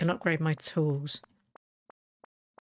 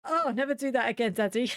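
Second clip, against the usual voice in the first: higher-pitched imitation of a recoiling little girl; four-year-old daughter; 5 semitones higher than usual. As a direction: mimic a small child telling Daddy off. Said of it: "She was about four".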